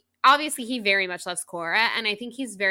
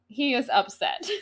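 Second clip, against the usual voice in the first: laughing; 7 semitones higher than usual. Real, not acted.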